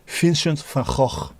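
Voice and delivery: "Vincent van Gogh" slowly, F for V, throaty ch for each G; Dutch pronunciation. Shown as a demonstration. Streamer repeating the Dutch name for chat, slowly. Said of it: "And then G is G".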